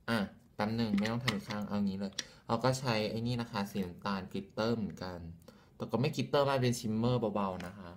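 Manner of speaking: usual speaking voice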